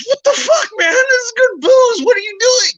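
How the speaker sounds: high cry of outrage; outraged shout. Acted. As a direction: yell in disbelief at the wasted booze. American outraged in joke.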